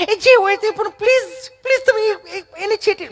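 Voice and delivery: high, stammering mock panic; hammed-up frightened city tourist; mimicked panicked pleading. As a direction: plead in comic falsetto.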